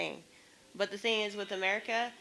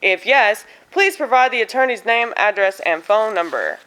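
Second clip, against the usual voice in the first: louder, brisk bureaucratic read of form questions; form read aloud; 2 semitones higher, 7 dB louder than usual. Acted. Vlogger reading each insurance form question aloud, exasperated formal read. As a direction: rattle off the questions with growing exasperation.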